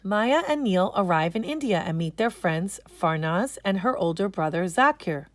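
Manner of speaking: usual speaking voice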